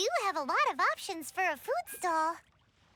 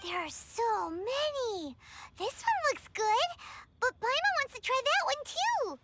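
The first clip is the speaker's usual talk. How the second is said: ordinary talk, nothing marked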